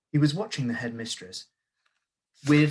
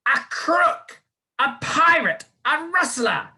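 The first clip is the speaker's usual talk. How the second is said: very high, louder screeching insults; screamed accusations; 8 dB louder, slower than usual. A narrator's reading. Headmistress screaming charges at boy, very high and loud. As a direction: screech each charge higher. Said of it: "the Trunchbull screamed".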